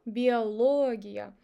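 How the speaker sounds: биология slowly, stretched on ЛО; stressed O. Shown as a demonstration. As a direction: lean loudly and long on LO in биология. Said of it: "If even stressed syllable".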